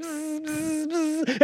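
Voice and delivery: high-pitched buzzing noise; repeated high bee hum; imitated bee buzz. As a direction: buzz like a bee round someone's face. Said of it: "it buzzed around his little boy's face".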